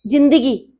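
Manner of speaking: "जिंदगी" with j instead of z; imitated mispronunciation. Shown as a demonstration. Urdu poet gives another commonly botched word, demonstrated mispronunciation. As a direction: show the slip, "जिंदगी" starting with a j. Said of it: "जिंदगी नहीं".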